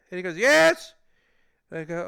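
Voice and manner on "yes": one high, shouted word; imitating an angry caller's scream; screaming support guy. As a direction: yell it back in exasperation. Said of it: "guy from DoorDash screamed at me".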